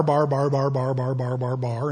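low babbling bar bar bar, softening; mock babble. Preacher mimicking barbarian speech as babble. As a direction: imitate foreign babble, trailing off. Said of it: "it just sounded like bar".

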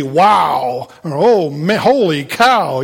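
amazed, drawn-out exclamations; demonstrating emphatic wonder. Shown as a demonstration. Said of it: "those are emphatic kind of statements".

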